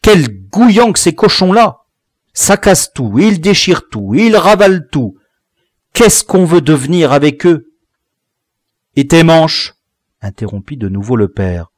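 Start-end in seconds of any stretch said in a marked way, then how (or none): 0.0-7.7 loud, high-pitched complaint; high, scolding tirade of despair; exasperated mother. A narrator's reading. Mother laments destructive children. 9.0-9.8 loud, raised-pitch question; sharp parental challenge; accusing father. A narrator's reading.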